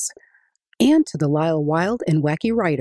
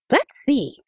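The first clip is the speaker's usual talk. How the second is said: put-on eager boy; 8 semitones higher than usual. Adika starts counting.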